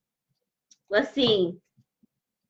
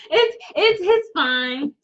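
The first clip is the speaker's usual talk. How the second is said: laughing through tears; 6 semitones higher, much slower than usual. Real, not acted. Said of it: "I'm crying because I love you and I'm happy".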